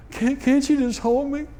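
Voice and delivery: high, pleading wife's voice; lightly voiced wife, pleading. Husband quotes his crying wife asking to be held, high pleading voice. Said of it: "she said".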